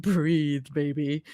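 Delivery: soft teasing coo; calm her with a tease. A narrator's reading. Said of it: "Mira teases".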